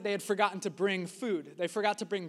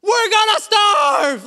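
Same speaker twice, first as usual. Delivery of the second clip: high, slow panicked shout; mock panic; 11 semitones higher, 13 dB louder, slower than usual. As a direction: wail it like hungry disciples in a hammed-up fright. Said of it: "and they're like".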